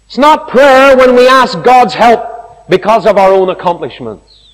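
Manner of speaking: loud, high, ringing proclamation; preaching at full force. Acted. Preacher to congregation, loud ringing declaration. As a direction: declare it to the whole hall.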